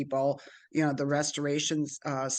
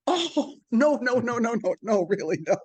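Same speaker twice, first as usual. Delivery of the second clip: much higher, louder embarrassed no-no-no, settling down; flustered protest; 8 semitones higher, 4 dB louder than usual. Retold or imitated. She refuses being named his replacement, flustered and high. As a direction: protest in a fluster, easing back. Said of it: "And I'm like".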